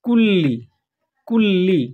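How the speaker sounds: কুল্লি with a long doubled ল. Shown as a demonstration. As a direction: hold the ল in কুল্লি. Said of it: "এখানে বলতে হবে কুল্লি". (দ্বিত্ব লাম জোর দিয়ে)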